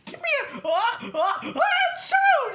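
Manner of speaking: building loud fake sneeze. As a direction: wind up then sneeze big. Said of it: "sneezed the cheerleaders".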